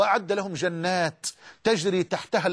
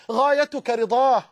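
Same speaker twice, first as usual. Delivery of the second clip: rising emphatic call; 4 semitones higher, 3 dB louder, slower than usual. Real, not acted. Preacher's climax to the audience.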